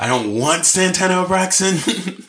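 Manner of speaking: hammed-up indignant refusal; exasperated protest. Acted exasperated phone customer.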